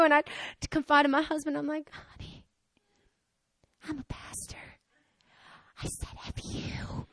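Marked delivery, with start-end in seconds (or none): 1.9-7.1 hushed, halting mock-shamed whisper; whispered confession, acted. Pastor confessing to her husband, ashamed whisper. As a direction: whisper it haltingly, one admission at a time.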